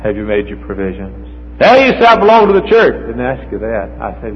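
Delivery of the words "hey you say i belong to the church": high, louder, smug protest; mock churchgoer. Preacher mocks a churchgoer's excuse, high smug voice. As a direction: lampoon a self-assured member.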